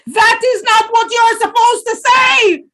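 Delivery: high, loud furious yell; even angrier shout. A narrator's reading. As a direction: lose his temper completely. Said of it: "Anansi shouted even more angrily".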